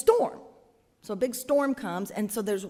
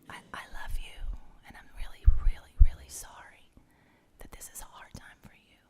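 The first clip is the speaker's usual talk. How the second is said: tender whisper; faster than usual. Acted. God comforting Job beside him, tender whisper. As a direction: whisper close, reassuring. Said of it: "God comforting Job".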